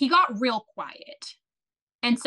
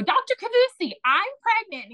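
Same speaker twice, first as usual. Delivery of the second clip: 1 excited announcement; 11 semitones higher than usual. Retold or imitated. Telling doctor she is pregnant.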